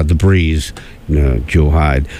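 low, slow name drop; big-dude voice. Acted. Radio host naming the absent meteorologist, big-dude voice. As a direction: drop the name deep and drawn out. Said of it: "I have to say it like that".

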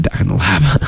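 whispered catchphrase, half to himself. Acted. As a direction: whisper it like a secret. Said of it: "I love saying".

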